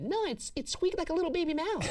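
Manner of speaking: very high squeaky mocking voice; mock witness voice. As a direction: pitch way up like a frightened witness. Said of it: "And they went".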